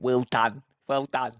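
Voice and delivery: loud mock praise; punchy, jeering echo of praise; mocking congratulations. Host parrots the man's congratulations, mocking. Mockingly echoes man in clip.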